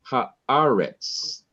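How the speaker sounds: Haaretz slowed, long stressed A before RETZ; stress on second-last syllable. Shown as a demonstration. Teacher showing where the accent falls, Haaretz stressed before the last syllable. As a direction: stretch the middle A of Haaretz. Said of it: "the accent is on the second to the last syllable".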